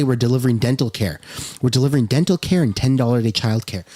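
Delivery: low, fast mocking recital; mocking predicted quote. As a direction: rattle off his talking points dismissively. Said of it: "And he's about to say".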